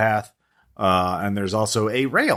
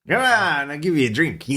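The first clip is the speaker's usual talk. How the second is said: wasted barfly; 5 semitones higher than usual. Acted. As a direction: pester someone at the bar as a giggly drunk. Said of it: "Oh, he's wasted".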